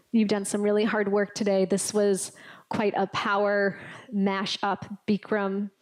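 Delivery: ordinary delivery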